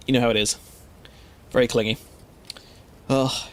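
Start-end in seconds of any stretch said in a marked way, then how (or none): none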